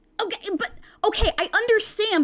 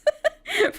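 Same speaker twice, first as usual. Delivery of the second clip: high burst of laughter. Real, not acted. Laughs after reading line.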